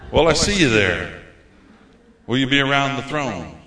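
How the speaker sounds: ordinary talk, nothing marked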